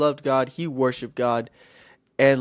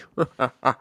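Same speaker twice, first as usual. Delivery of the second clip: short villainous giant's chuckle; mocking laugh. Giant jeering at his cowardly foes, mocking laugh. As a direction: cap the taunt with scornful laughter. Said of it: "Goliath was just making fun of them".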